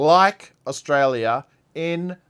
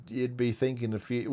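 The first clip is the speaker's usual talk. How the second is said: ordinary talk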